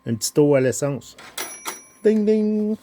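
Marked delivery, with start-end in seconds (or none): 2.0-2.8 ding ding. Pretend. Imitating a bell sound.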